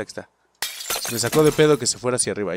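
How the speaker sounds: usual speaking voice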